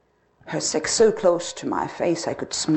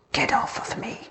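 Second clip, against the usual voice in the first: cold whispered dismissal; turned away, whispering; 5 semitones higher, 5 dB quieter than usual. Acted. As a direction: hiss it away under your breath. Wife rejects him in bed.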